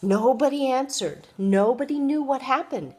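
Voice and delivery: ordinary delivery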